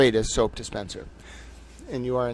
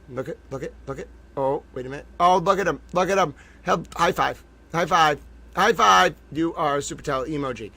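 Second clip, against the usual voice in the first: ordinary talk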